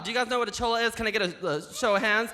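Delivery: normal talking voice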